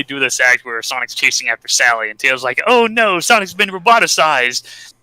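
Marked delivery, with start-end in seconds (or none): 2.7-4.9 high-pitched, hammed-up dismay; mock alarm. Tails seeing Sonic roboticized, retold in mock alarm. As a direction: cry out in pretend shock.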